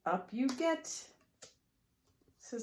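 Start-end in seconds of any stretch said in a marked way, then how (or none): 0.0-0.9 higher, louder cheerful call; lightly voiced witch calling riders aboard; inviting little witch. Witch invites friends aboard again.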